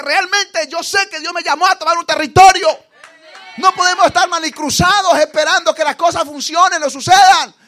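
loud, high preaching build to shouting; fiery preaching. Acted. Preacher shouting to the congregation at full force.

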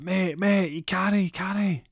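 high, slow, drawn-out pleading; alarmed protest. Acted. Friend begging the wounded man not to come, high alarmed protest. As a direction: plead loudly in a put-on high voice. Friend refusing panicked caller.

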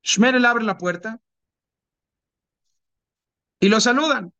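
normal talking voice

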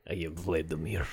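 mock Russian accent, lightly done. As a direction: introduce yourself in a Russian accent. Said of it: "Do a Russian accent the whole".

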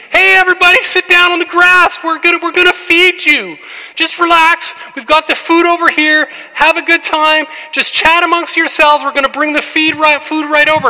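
high, loud shout to the crowd; Jesus calling out to the crowd, very high and loud. Retold or imitated. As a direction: call everyone to sit down on the grass. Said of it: "he says".

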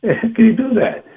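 high laughing, incredulous question; laughing disbelief. Real, not acted. Preacher laughing in disbelief at Adam's denial, high and amused. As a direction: laugh out the disbelief at such ingratitude.

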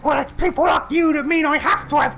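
high indignant hag shout; witch shouting. Acted. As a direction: yell it like a furious crone.

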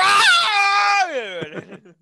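long, loud, high-pitched scream; mock terrified scream. Host playing the king attacked by chainsaw mid-speech, mock scream. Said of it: "Leatherface just breaks in".

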